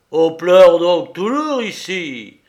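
gros cri de colère, lent et rauque; rude marin qui s'écrie. A narrator's reading. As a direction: crie comme un marin brutal. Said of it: "s'écria-t-il". (marin furieux qui crie)

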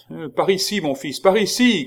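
loud high call across the woods; shouting with full force. A narrator's reading. Chicot calls out loudly to the king. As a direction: Chicot yells to the king with all his strength. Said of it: "cria-t-il de toute sa force".

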